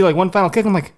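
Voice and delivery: normal talking voice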